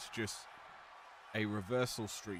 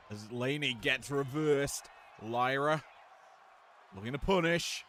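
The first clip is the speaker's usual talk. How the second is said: higher, louder hyped call of the action; live play-by-play; 5 semitones higher, 5 dB louder than usual. Acted. Commentator calling the wrestling match, hyped. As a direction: call every hit with rising energy.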